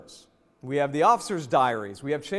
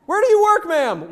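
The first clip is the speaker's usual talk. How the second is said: much higher and louder, laughing disbelief; shocked parent; 8 dB louder than usual. Retold or imitated. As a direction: squeal like a horrified parent at a toy company. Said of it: "I'm like".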